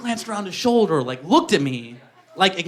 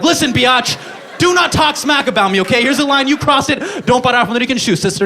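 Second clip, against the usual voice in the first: sassy angry rant; 5 semitones higher than usual. Retold or imitated. Confronting the ex from her closet, mock sassy shout. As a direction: tell her off like a diva. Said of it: "I was like".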